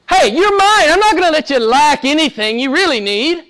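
high, insistent voice as God; loud opening, high-pitched reassurance; emphatic divine promise. Acted. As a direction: raise the voice to claim someone firmly. God's speech acted out, emphatic.

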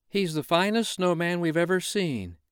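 put-on high, bright children's voice. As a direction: play the excited kids. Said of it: "said the children".

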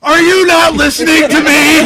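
high, very loud demand; imitated exasperated yelling. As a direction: bellow it at someone who won't listen. Voices exasperated man under attack.

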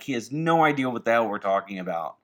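ordinary talk, nothing marked

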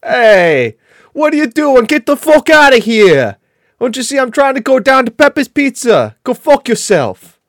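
high, loud mock New York accent; exaggerated New York street shouting, stretched line endings. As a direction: yell like an irate New Yorker, hammed-up accent. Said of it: "probably trying to New York".

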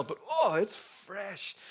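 ordinary delivery

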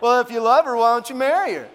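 loud, whiny-kid style comic impression; Pee Wee Herman. Preacher imitating God's unexpected answer, childlike comic voice. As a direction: put on a loud cartoonish kid voice. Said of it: "dare I say, Pee Wee Herman would say".

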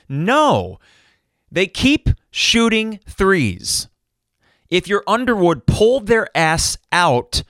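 exasperated fan losing his cool, pitch climbing; worked-up rant. Real, not acted. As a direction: let the frustration boil over, real anger. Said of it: "refusing to learn how to stay calm".

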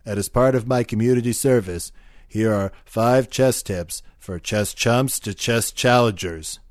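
brash chess hustler ad read; cocky ad voice. Acted. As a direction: sell it like a tough-guy pitchman. Chester ad read in character.

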